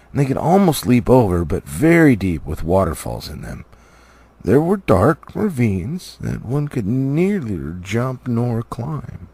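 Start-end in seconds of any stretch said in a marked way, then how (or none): none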